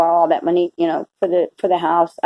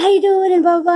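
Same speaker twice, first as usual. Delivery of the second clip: bright, high, louder hello; cheery stranger's greeting; 10 semitones higher, 4 dB louder than usual. Retold or imitated. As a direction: call it out like a beaming stranger. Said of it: "He's like".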